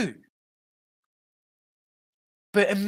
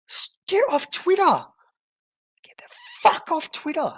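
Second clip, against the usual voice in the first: exasperated; 11 semitones higher than usual. Real, not acted. Yelling at the poster.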